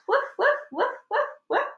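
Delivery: high yappy woofs; little dog barking. Acted. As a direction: bark like a panicked little dog. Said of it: "Yuri barked and barked".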